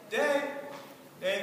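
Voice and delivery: calling out. Acted. Shane looks for Dave, calling out loudly. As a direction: holler for a friend.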